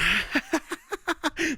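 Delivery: high-pitched forced cackle; put-on laugh to wind someone up; purposely annoying laugh. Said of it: "I can't do the laugh".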